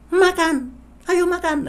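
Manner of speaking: very high, loud "Makan, ayo makan" as a scold; an angry parent who means stop; furious sarcastic order. Shown as a demonstration. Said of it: "tapi nadanya kan marah".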